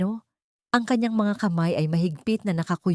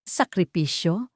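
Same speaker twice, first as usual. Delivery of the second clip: slow, bitter disbelieving echo; a wife's slow, bitter scoff at her husband; slower than usual. A narrator's reading. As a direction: spit the word back with a bitter laugh. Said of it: "Mapait na tawa ni Soledad".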